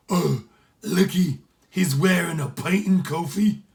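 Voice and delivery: loud, high, slow Irish brogue; gleeful Irish pirate accent; Irish accent, amused. Acted. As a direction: marvel at the shirt in a thick Irish accent. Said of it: "Sean's accent was so heavy".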